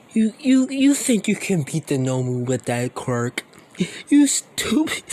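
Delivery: high, loud cackling villain voice; Shigaraki taunting. Acted. Villain mocking the hero's quirk, high-pitched taunt. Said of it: "Shigaraki starts laughing".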